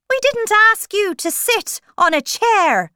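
high, loud March Hare voice; indignant scolding. A narrator's reading. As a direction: scold the uninvited guest loudly.

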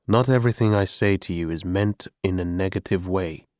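plain reading voice

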